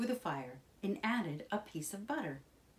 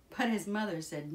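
usual speaking voice

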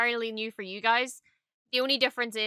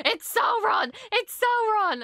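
mock screaming; 11 semitones higher, 4 dB louder, slower than usual. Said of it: "Everyone is screaming".

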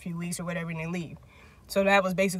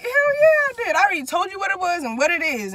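high-pitched, emphatic acted comeback; retold indignant retort; 8 semitones higher, 4 dB louder than usual. Retelling her answer to him after leaving, indignant. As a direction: throw it back at him, indignant.

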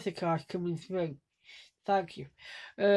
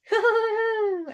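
very high put-on laugh; demonstrated laugh; 13 semitones higher, 4 dB louder than usual. Shown as a demonstration. Vlogger showing a sort of laugh, very high. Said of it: "it's a laugh like".

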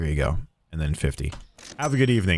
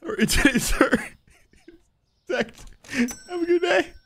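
laughing; 12 semitones higher than usual. Real, not acted. Cashier to customer, still laughing breathlessly. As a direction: squeeze the greeting out between laughs.

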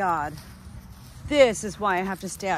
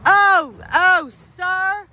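high, loud cry of alarm; alarmed scolding shout; 9 semitones higher, 5 dB louder, much slower than usual. Real, not acted. Owner to dog caught with bird, alarmed shout.

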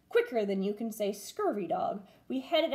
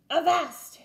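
louder pirate halt; pirate yell; 7 semitones higher, 6 dB louder than usual. A narrator's reading. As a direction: yell avast. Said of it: "yelled Braidbeard".

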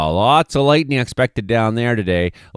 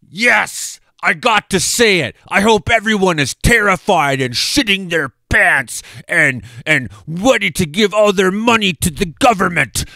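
high, put-on sarcastic weatherman voice; sneering doom-monger weatherman act; mock alarmist weatherman; 7 semitones higher than usual. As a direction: parody a fearmongering forecaster. Mocking alarmist weatherman persona.

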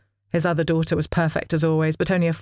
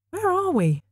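surprised; 14 semitones higher than usual. A narrator's reading. Aphrodite startled by new place.

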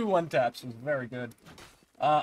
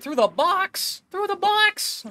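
very high, loud yelp, climbing higher; incredulous cry; 6 dB louder, slower than usual. Real, not acted. Gamer stunned by another shot through cover, incredulous cry. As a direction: cry it out in disbelief, stretching the first.

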